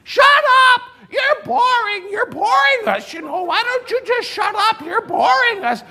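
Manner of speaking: put-on high, strained old lady voice; cranky old woman. Old woman heckling the preacher, high and squawking. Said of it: "and a woman says".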